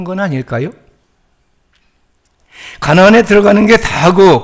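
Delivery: impassioned pulpit appeal, building; rousing preaching. Acted. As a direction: drive the rhetorical questions home to the pews.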